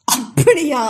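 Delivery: a mocking laughing "really?"; loud mocking laugh. A narrator's reading. Kavi mocking Ashok's claim of love, laughing. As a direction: laugh in his face. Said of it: "சத்தமாக சிரித்தவள்".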